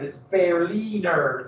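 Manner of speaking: "Berliner" said the German way, slowly, with a German r; careful German "Berliner", slow and exact; proper German Berliner. Shown as a demonstration. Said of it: "to say it in proper German".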